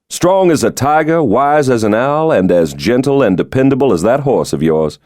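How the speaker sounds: genial president. Acted. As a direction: play the charming president joking about his security.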